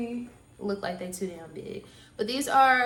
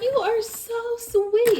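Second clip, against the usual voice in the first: gushing; 13 semitones higher, 7 dB louder, slower than usual. Retold or imitated. Gushing quote to kind neighbor.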